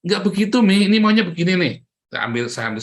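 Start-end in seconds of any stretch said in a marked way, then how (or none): 0.0-1.7 high, loud fed-up correction; retold burst of irritation; exasperated. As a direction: cut in, fed up with the fussing. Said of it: "Saya gusar jadinya".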